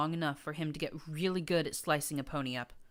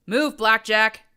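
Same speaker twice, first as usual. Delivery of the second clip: higher, loud bellow; roar; 7 semitones higher, 15 dB louder, slower than usual. A narrator's reading. Charging mare roaring at Blackjack to move. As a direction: roar it down the hall. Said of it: "roared a mare".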